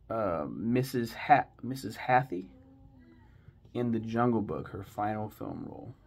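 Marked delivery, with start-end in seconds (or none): none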